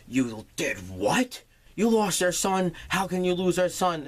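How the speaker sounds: hammed-up parental outrage; mock outrage. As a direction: scold her for losing the kid. Parents scold Nova over lost son.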